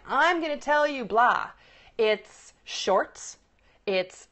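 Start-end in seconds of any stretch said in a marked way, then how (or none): 0.1-1.5 broad headline. Acted. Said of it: "isn't just a broad headline".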